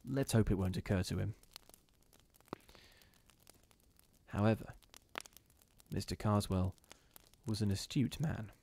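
measured storybook narration; story reading. A narrator's reading. Reading the story to his live viewers. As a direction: read it like a ghost story.

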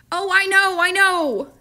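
put-on a touch of a remorseful child; woeful boy. A narrator's reading. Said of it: "John admitted woefully".

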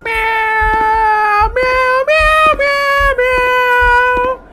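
very high, drawn-out meowing voice; cat voice. Pretend. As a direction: talk like a cat in slow, high mews. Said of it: "I'm speaking in a cat voice".

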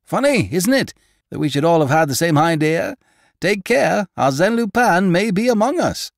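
high, loud, excited reporter; exclaiming reporter. A narrator's reading. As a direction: call out the joke to the crowd. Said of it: "one of them exclaimed".

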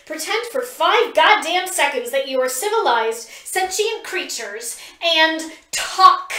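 exasperated scolding. A narrator's reading. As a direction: tear into them both. Pip scolds both fighters.

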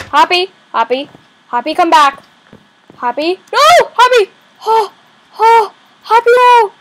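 loud high panicked calls; loud alarmed shouting of her name; shouting for pet. Real, not acted. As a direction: yell after the runaway bunny. Pet bunny jumping off.

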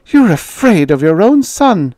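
high, loud accusation; struggling cry. A narrator's reading. Wife struggling against her frightened husband, accusing cry. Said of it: "she cried, struggling".

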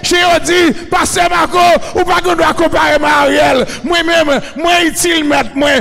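shouted, driving sermon in short bursts; full-force preaching. Real, not acted. Preacher rousing the crowd to cry alléluia, full-force preaching. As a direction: fire each phrase out at the congregation.

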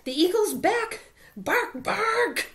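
high, loud warning shouts building to a piercing bark; watchdog alarm. Acted. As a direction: sound the alarm to everyone.

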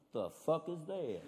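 low, slow, quiet disbelief; soft Southern-yokel puzzlement; small-town Southern local. Acted. Gas station local eyeing a hybrid, quietly baffled. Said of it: "You pull into a small gas station".

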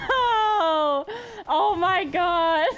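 loud anguished cry; shouting in dismay. Real, not acted. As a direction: cry out in despair. Reacting to donation, panicked.

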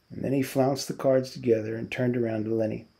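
ordinary delivery

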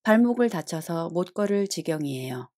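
ordinary talk

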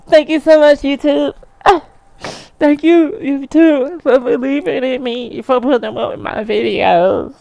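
high, hammed-up weepy thanks; dramatic tearful speech. Award winner thanking YouTube at the microphone, mock tearful. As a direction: pour out overwhelmed gratitude, fake tears rising. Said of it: "I mean, that's so dramatic".

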